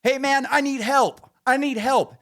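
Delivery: high, urgent mock yell; screaming for help. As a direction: act out a plea shouted at someone. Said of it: "just screaming at this fucking guy".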